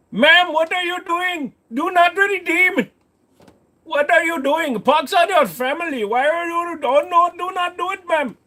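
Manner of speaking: put-on Indian-accented scammer begging her not to redeem; panicked scammer accent. Scam caller begs a woman in an Indian accent, alarmed. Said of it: "stop doing that accent".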